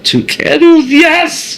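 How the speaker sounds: high-pitched happy cheer; excited cheer. Acted. Mock cheering about candles.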